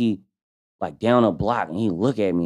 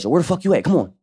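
eager call; 8 semitones higher, faster than usual. Retold or imitated. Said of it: "Ike, like".

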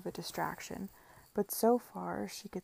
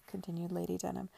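ordinary delivery

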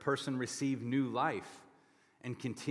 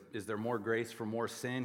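usual speaking voice

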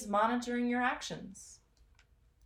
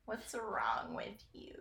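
slow, soft whispered reproach; mock-accusing whisper; 8 dB quieter, slower than usual. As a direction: whisper it slowly, as if appalled. Mock aside after disliked apple butter.

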